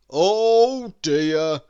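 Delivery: loud, booming fatherly Santa voice; a big jolly man caught in a fix; Santa's dismayed oh dear. A narrator's reading. Santa, stuck in the snow, sighing to himself: loud dismayed Santa voice. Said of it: "he said out loud".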